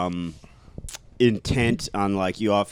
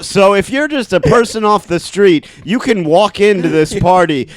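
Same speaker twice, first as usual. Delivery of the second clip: normal talking voice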